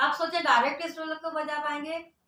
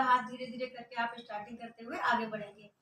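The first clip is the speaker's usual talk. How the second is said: normal talking voice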